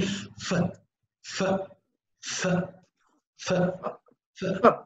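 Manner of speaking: "f" as a long, voiceless hiss of air between lip and teeth; voiceless f sound. Shown as a demonstration. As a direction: blow a steady f with no voice at all. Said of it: "Repeat after me".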